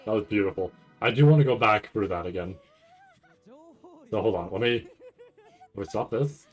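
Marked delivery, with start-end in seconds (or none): none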